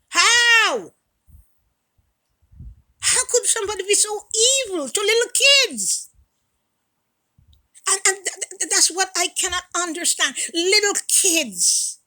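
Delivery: high, loud pained outrage; outraged disbelief. Real, not acted. Outrage at cruelty to children.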